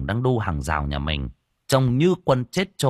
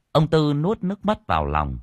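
plain reading voice

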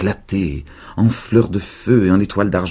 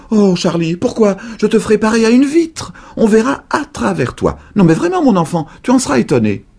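voix de vieille très aiguë et forte; vieille dame exaltée; 6 dB louder than usual. A narrator's reading. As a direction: promettre avec une ferveur de sorcière. Vieille dame enthousiaste promet. (la vieille dame promet l'invisibilité, très aiguë)

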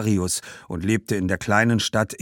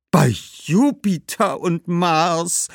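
higher, slow astonished oath; exclaiming; 9 semitones higher, 4 dB louder, slower than usual. Acted. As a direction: cry out in amazement. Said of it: "rief Herodias". (Herodias ruft erstaunt aus)

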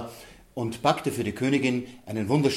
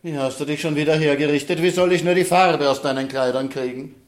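higher, louder nagging mother; evil queen; 4 semitones higher, 5 dB louder than usual. Acted. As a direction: scold the paint-covered child. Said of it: "da sagte sie".

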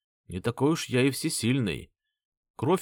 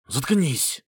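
barking; 3 semitones higher than usual. Acted. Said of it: "рявкнул лысый".

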